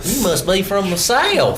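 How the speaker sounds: high, put-on Southern voice; a mocking Southern yokel; Southern accent. Cohost joking about Southern gamers in a Southern accent. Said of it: "brings in the Southern accent".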